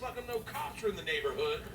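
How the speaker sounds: ordinary delivery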